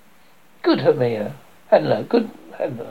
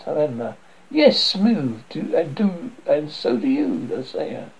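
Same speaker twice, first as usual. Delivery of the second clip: ordinary talk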